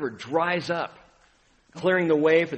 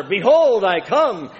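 Behold rings out much louder and higher; louder proclamation; 4 semitones higher, 9 dB louder, slower than usual. Retold or imitated. Pastor building his sermon's refrain, loud and rising. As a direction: push the phrase out over the congregation. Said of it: "louder and louder and louder".

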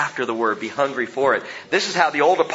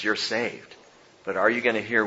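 ordinary delivery

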